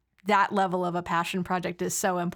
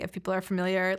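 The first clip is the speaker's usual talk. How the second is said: ordinary talk, nothing marked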